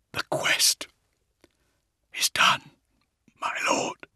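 halting dying whisper; faint, voiceless final report; barely a whisper. A narrator's reading. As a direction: whisper it with your last breaths, long pauses between. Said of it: "his voice barely a whisper".

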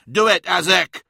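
high, loud, sharp command; snapped order. A narrator's reading. Magnus orders Azek to obey, snapped. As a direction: snap it out as an order. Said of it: "snapped Magnus".

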